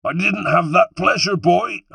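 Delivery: high, loud West Country taunt; jeering West Country villain, shouting back; West Country call. A narrator's reading. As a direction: call back over your shoulder in a West Country accent. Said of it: "he called back over his shoulder".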